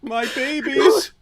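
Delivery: high, drawn-out mock lament; mock wailing. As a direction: wail slowly as if bereft. Mock grief over deleted videos.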